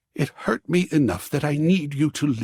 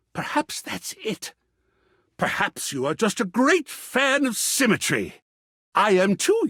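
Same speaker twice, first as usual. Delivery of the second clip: normal talking voice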